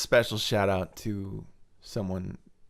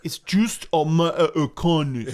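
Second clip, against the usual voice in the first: loud, higher mock British tough-guy accent; British working-class tough guy; 5 semitones higher, 7 dB louder than usual. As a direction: put on a hammed-up British tough. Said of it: "that's the working class tough guy".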